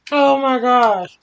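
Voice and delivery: ordinary delivery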